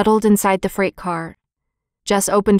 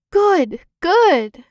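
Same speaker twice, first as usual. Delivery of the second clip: high, glad cheer; excited cry; 9 semitones higher, 3 dB louder than usual. A narrator's reading. Violet cheering the thorn out, high and glad. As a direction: cry out with joy as the thorn comes free. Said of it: "cried Violet".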